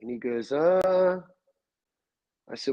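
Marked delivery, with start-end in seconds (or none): 0.5-1.2 higher, drawn-out clueless hesitation; retold stumped. Nephew stumped by uncle's hunting question, imitated hesitation. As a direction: imitate someone with no answer.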